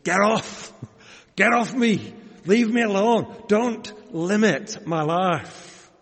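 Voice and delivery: put-on Yorkshire accent. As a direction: shout it off in broad Yorkshire accent. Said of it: "in our Yorkshire accents".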